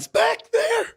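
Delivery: tiny high-pitched whimper; mock crying. Interviewer panicking under the actor's tirade, mock tearful. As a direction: squeeze it out in pretend tears. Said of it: "I'm crying".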